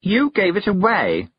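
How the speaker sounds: high, slow accusation; sharp. A narrator's reading. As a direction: accuse her, stretching each word. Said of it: "said a sharp voice behind her".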